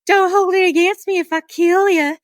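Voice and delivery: put-on sweetly threatening in a Southern accent; country Southern accent. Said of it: "in her country southern accent".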